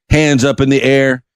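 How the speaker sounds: ordinary delivery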